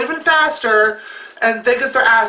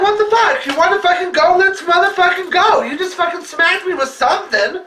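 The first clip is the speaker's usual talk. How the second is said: furious challenge; 8 semitones higher than usual. Retold or imitated. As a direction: scream it at the fleeing car. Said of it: "I'm like".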